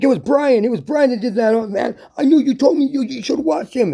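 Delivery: very high, hammed-up accusation; high-pitched acted blame; frantic blaming. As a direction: pin it on someone else in a mock panic. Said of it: "they'll let you blame whatever".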